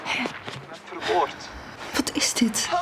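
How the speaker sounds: whispered, bewildered question; hushed puzzled whisper; whispered confusion. Acted. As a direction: ask yourself under your breath. Confused on voicemail.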